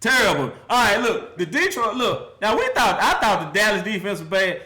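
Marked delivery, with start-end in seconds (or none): none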